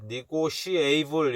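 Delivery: "니고시에이블" quickly, with shi-ei-bul at the end; mistaken pronunciation. Shown as a demonstration. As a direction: toss out the wrong "니고시에이블" as a counterexample. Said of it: "이 아니라". ("니고시에이블"로 '시에이'를 넣어 잘못 발음)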